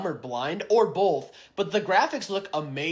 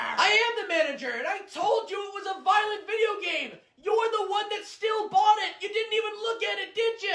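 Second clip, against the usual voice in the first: very high, voice-straining yell; exasperated shouting. Acted. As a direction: lose your patience and scream back. Clerk finally snaps in quarrel.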